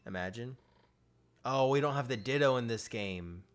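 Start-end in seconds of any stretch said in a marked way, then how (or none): 1.4-3.4 loud dismayed realization; surprised. Real, not acted. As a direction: blurt it out in shock. Discovers missing Pokemon.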